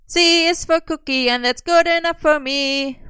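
high, slow, loud chant; drawn-out hollering; hollered. A narrator's reading. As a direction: belt it out slowly at high pitch. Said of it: "Merlin hollered".

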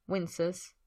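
ordinary delivery

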